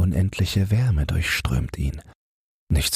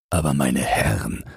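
unbothered official, usual volume; indifferent; 3 semitones lower than usual. A narrator's reading. Stationmaster to stranded passengers, indifferent. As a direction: brush them off coolly. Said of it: "sagt der Vorstand gleichgültig".

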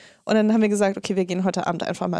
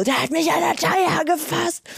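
higher, agitated self-quote; higher voice telling a friend she was grabbed; upset retelling; 6 semitones higher than usual. Retold or imitated. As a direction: blurt it out to a friend. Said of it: "Ich so".

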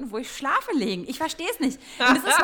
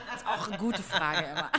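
normal talking voice

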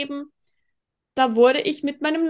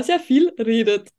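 breaking into laughter mid-sentence; laughing. Real, not acted. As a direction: laugh through the last words. Teasing compliment, laughing.

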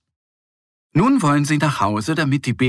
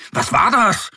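high, louder startled cry; startled animals; 12 semitones higher, 5 dB louder than usual. Acted. Forest animals startled by the clap, crying out. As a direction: jump at it, alarmed. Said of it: "sich erschrocken".

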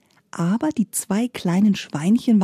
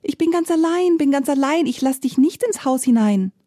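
high, bright little piglet voice, lifted well above the narration; a tiny pig chanting from behind the door; kleines Schweinchen; 7 semitones higher than usual. A narrator's reading. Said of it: "Das dritte Schweinchen aber antwortet". (hohe Ferkelstimme, singend)

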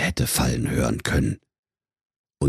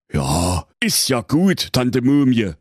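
hoher, empörter alter König; König Holzbrot; 5 semitones higher, 3 dB louder than usual. Acted. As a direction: frech meckernder König. König spricht. (hohe Königsstimme)

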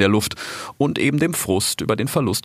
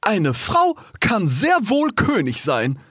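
high, slow, emphatic rebuttal; giraffe calling out; 11 semitones higher, slower than usual. A narrator's reading. Giraffe rebuts the rhino, high and emphatic. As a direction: contradict firmly in a high voice. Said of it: "rief da eine Giraffe".

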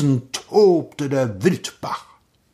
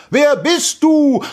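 slow, high-pitched shout; 7 dB louder, slower than usual. A narrator's reading. Prince demanding the echo's identity, slow loud shout. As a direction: shout each word into the gorge. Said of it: "schrie er".

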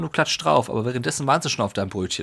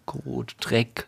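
noticeably softer, almost under the breath; hushed; 7 dB quieter than usual. Real, not acted. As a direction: drop your volume on the dirty words. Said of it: "du brauchst Kot nicht flüstern". (gedämpft, fast geflüstert)

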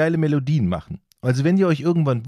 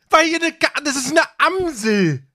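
indignant outburst; 13 semitones higher than usual. Real, not acted. Defending the blackbird to ignorant friends, exasperated. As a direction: insist in disbelief. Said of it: "Jetzt empört er sich wieder".

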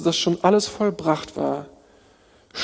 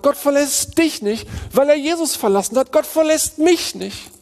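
full-force preaching; 6 semitones higher than usual. Acted. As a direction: preach it out, lifting the room.

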